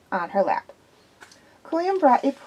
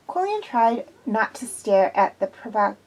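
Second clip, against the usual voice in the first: ordinary talk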